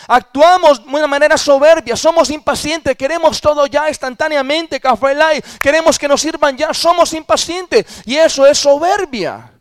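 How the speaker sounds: high, loud, emphatic sermon voice; impassioned pulpit proclamation; full-force preaching. Real, not acted. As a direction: drive the exhortation home, stretching key words.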